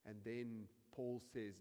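usual speaking voice

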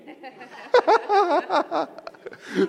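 high, far-off laugh; sudden amused laugh; burst of laughter. Real, not acted. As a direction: laugh out at the irony. Laughs at the irony.

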